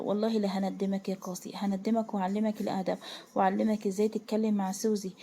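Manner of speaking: ordinary delivery, nothing marked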